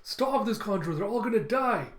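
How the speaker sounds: put-on high, loud pleading child voice; Momonosuke crying out. Captured child grabbing his captor, high desperate plea. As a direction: imitate a desperate kid begging. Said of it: "You have Momonosuke crying".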